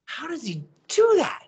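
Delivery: high, astonished asking; amazed disbelief. Retold or imitated. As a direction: marvel at it, pitched far up. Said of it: "I'm like".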